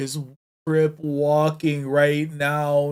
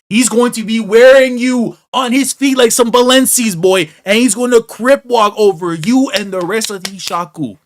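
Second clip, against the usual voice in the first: loud, higher hype-man yelling; hyped shouting; 8 semitones higher, 10 dB louder than usual. Real, not acted. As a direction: promise revenge like a fan losing it. Hyping hero's coming revenge.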